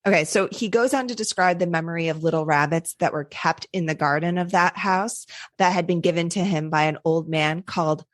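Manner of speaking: normal talking voice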